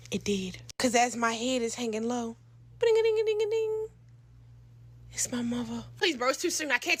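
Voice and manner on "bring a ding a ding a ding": high, monotone sung ring; imitated phone ringtone. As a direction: trill like a ringing phone.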